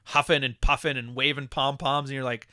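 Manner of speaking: usual speaking voice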